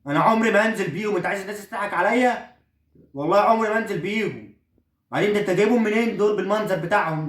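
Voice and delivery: a son protesting he will never wear them; indignant son. Acted.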